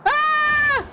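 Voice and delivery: loud sudden yelp of surprise; real shocked yell; startled shout. Real, not acted. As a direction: cry out at the shock. Player startled by the fall.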